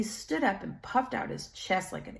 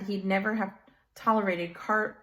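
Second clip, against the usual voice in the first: ordinary delivery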